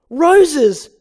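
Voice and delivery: high, loud yell; excited shout. Acted. Barry yells at Vanessa's cab window, a loud high shout.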